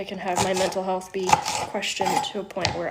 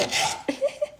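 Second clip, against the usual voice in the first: high airy giggle; 4 dB quieter than usual. Real, not acted. Laugh after joke's on you.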